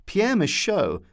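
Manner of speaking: ordinary delivery, nothing marked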